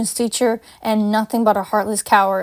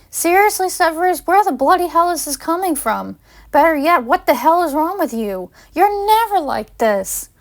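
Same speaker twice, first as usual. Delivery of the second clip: high, pitched-up exasperated disbelief; exasperated outburst; 7 semitones higher than usual. Acted. Paige confronting Severus, a high exasperated outburst. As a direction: demand answers in rising outrage.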